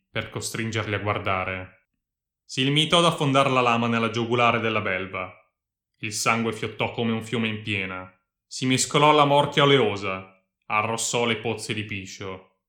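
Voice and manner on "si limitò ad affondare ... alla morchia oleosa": usual speaking voice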